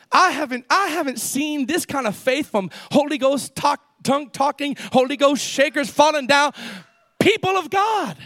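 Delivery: high, driving pulpit voice; forceful preaching. Real, not acted. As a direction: preach it urgently to the congregation.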